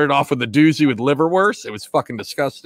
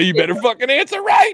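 mock threatening shout; 8 semitones higher than usual. Real, not acted.

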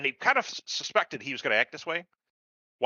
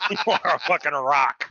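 laughing while hailing Pike; cracking up mid toast; 5 semitones higher, 5 dB louder than usual. Acted.

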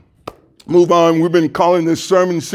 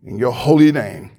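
earnest pastoral prayer, unhurried with pauses. Acted. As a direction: pray it aloud over the congregation. Pastor prays before the sermon.

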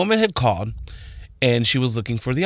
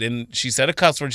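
ordinary talk

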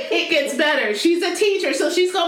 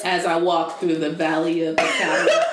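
mock solemn psalm; 11 semitones lower than usual. Mock psalm about Chicago.

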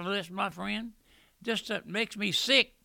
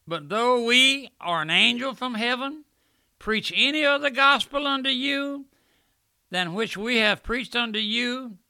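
raised, louder pulpit preaching; preaching at full force; 6 semitones higher, 6 dB louder than usual. Acted. Preacher warning his flock, at full force. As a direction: thunder the verse out to the congregation.

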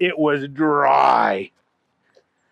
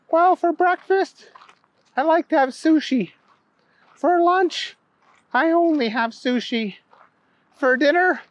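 put-on high little girl listing her meals; small high-pitched child voice; 13 semitones higher than usual.

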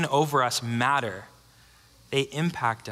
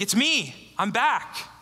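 mock heroic announcement; 7 semitones higher, much slower than usual. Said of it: "He doesn't say, like".

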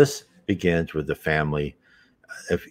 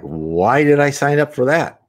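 higher-pitched, incredulous complaint; exasperated complaint; 8 semitones higher, 3 dB louder than usual. Acted. Worn-down soul questioning these trials, exasperated. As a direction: protest it with a raised pitch.

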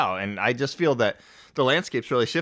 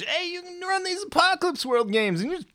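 much higher, excited gamer voice; high enthusiastic friend voice; 4 dB louder than usual. Retold or imitated. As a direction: gush like an eager convert. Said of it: "Brendan was saying".

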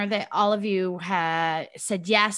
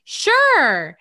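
mom saying sure; 5 semitones higher, 7 dB louder than usual. Retold or imitated. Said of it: "she's like".

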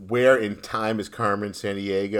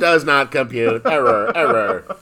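loud malfunctioning robot; 2 semitones higher, 7 dB louder than usual. Pretend. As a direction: blare it like a broken computer. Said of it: "sound like we have robot voices".

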